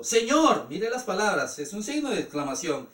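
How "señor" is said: Señor read with a firm raised call; read out cry. Retold or imitated. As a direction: announce the blind men's call to the Lord. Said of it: "Me imagino qué gritos".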